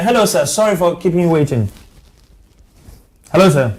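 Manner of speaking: loud, high call down a bad phone line; to someone hard of hearing. Real, not acted. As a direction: raise your voice so the caller hears. Caller barely audible on phone line.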